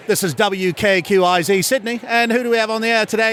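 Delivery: brisk Australian announcer, pitch climbing; Australian radio host. Acted. Radio host greeting a caller live, Australian accent. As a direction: open the show in an Australian accent. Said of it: "this Australian radio station's holding a contest".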